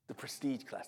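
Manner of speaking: hushed, savouring repeat; very soft, impressed reveal; awed whisper. Shown as a demonstration. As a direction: whisper the name with mock reverence.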